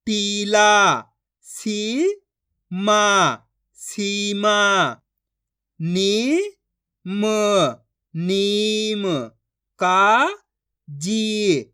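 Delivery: each syllable stretched long and held; very slow syllable-by-syllable reading demo; drawn-out pronunciation. Shown as a demonstration. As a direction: draw out every vowel like a reading teacher. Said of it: "आपको खींच के पढ़ना है".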